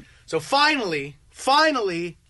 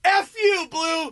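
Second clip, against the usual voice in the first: high, belligerent challenge to everyone; furious shout; 7 semitones higher than usual. Retold or imitated. Ejected player challenging everyone, furious yelling. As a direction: roar it out at a high pitch. Acted tirade after being ejected.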